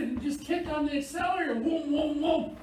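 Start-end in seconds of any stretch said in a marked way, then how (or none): 1.5-2.6 high, loud vroom of a revving engine; mock gas-pedal revs; engine revving noise. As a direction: rev the engine with your voice. Mimics revving a stalled car.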